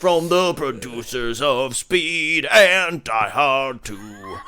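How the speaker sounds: booming trailer read with a laugh; trailer announcer. Retold or imitated.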